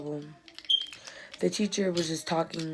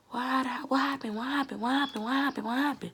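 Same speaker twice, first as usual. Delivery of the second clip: put-on pestering boy; 7 semitones higher than usual. Boy pestering, repeated question.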